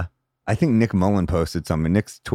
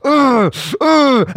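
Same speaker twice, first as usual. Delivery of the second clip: high, loud angry grunts; two hammed-up angry grunts; worked-up growls; 5 dB louder than usual. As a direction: grunt like you're about to blow. Lewis getting worked up.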